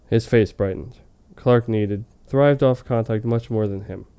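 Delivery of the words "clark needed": ordinary talk, nothing marked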